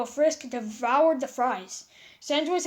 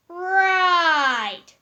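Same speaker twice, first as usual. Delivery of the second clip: loud, high, drawn-out "Right!"; awkward exclamation; 5 semitones higher, 9 dB louder than usual. Acted. Papyrus backing away from Sans and Frisk, loud awkward exclamation. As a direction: shout it as you back away slowly.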